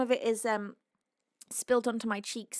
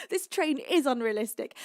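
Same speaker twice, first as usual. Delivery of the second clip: usual speaking voice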